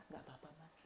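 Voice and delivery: barely-there, very soft forgiving answer; soft gentle reply. Retold or imitated. Passenger softly forgiving the steward, very soft. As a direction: breathe the reply almost inaudibly. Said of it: "Yang ngomong gitu ternyata bukan ibu-ibu".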